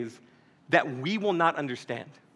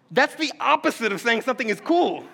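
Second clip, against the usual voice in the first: ordinary talk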